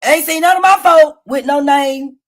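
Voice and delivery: ordinary delivery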